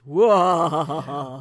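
loud, drawn-out wail of mock suspense; mock dramatic "wah". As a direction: belt out a long, theatrical "waah". Mock dramatic reveal about missing ring.

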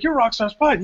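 high, mocking announcement; Ethan Carter's voice. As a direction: say it like sizing someone up. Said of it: "He's like".

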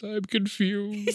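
put-on slow, soft, high old man; confused grandfather. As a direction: breathe it out, lost and puzzled. Imitating grandfather's line.

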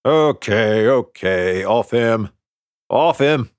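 lightly voiced coach calling players off; a touch of firm coach, breaking up a fight; coach intervening. A narrator's reading.

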